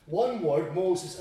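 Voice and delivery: ordinary delivery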